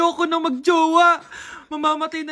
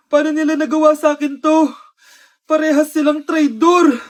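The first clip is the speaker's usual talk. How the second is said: ordinary talk, nothing marked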